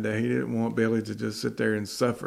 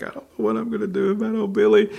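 put-on higher, fast lament of a distraught old man; despairing farmer; 11 semitones higher, 3 dB louder, faster than usual. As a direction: moan it like a farmer on the verge of tears. Farmer near tears on bench.

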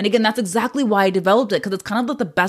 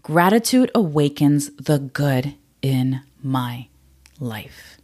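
slow mantra recital; 4 semitones lower, much slower than usual. Shown as a demonstration. Giving listeners her last mantra, slow and fading. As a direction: let the last word drop away slowly. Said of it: "the last mantra I have for you is".

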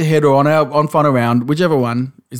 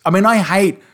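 normal talking voice

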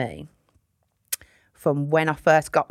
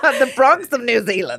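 put-on loud, high, joking New Zealand accent; bad New Zealand accent; 6 semitones higher, 5 dB louder than usual. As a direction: play up a terrible New Zealand accent for laughs. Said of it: "that was a really shit accent".

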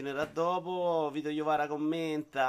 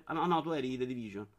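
normal talking voice

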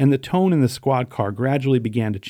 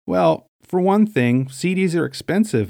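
riffing; 7 semitones higher than usual. A narrator's reading. Caught shoplifter makes up an excuse for store employee, riffing. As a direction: stretch it out as if inventing reasons. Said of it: "I riffed".

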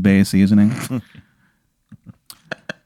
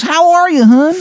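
much higher, hammed-up awful Maryland accent; terrible Maryland accent. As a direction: ham up the worst Maryland accent imaginable. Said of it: "the worst Maryland accent you have ever heard".

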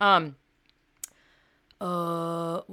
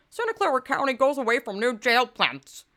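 higher, louder, exaggerated put-on reading; mock-dramatic voice, pitched up; hammed-up headline mockery; 6 semitones higher, 4 dB louder than usual. Shown as a demonstration. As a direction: ridicule the headline with an over-the-top delivery.

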